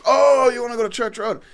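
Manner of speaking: put-on loud enthusiasm; retold eager recognition. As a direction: imitate an over-keen local pouncing on the question. Retold local eagerly giving directions.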